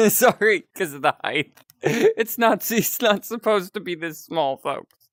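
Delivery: high-pitched, giggly apology; laughing. Real, not acted. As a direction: talk through real giggles, voice jumping up. Laughing at tiny avatar height.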